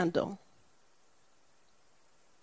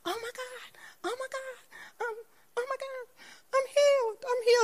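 very high, crying oh my God; high sobbing cry of amazement, healed; ecstatic crying scream. Retold or imitated. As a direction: shriek with joy through tears. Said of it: "she started screaming".

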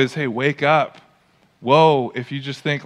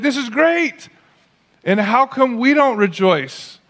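much higher, thrilled burst of joy; pumped Paul; 7 semitones higher, slower than usual. Retold or imitated. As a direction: blurt it out like a man overjoyed in prison. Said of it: "Paul is so pumped".

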